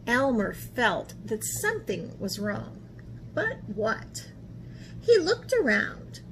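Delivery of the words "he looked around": plain reading voice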